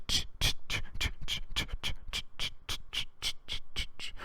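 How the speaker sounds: unvoiced imitated sleigh bells, fading; whispered bell jingle. Approaching sleigh bells imitated in a fading whisper.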